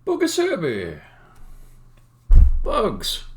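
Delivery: high, loud hammed-up Italian accent. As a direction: greet them like a cartoon Italian, in an Italian accent. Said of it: "say Bugaserbi in an Italian accent".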